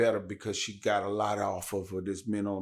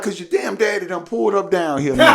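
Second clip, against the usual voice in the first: frantic mom, laughing; 8 semitones higher, 9 dB louder than usual. Retold or imitated. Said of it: "She was like".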